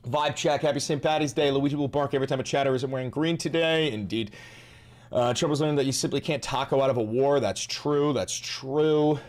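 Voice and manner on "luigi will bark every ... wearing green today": normal talking voice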